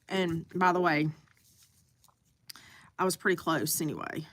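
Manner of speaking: crunching food while talking; mouth full. Real, not acted. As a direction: talk through a mouthful of wontons. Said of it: "Sorry, I'm crunching on wontons".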